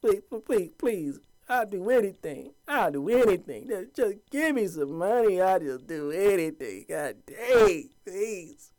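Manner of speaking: high, put-on pleading voice; hammed-up pleading slave; begging. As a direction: beg the master desperately, mock-pitiful. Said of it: "begging, pleading".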